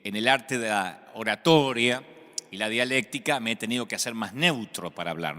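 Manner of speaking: ordinary delivery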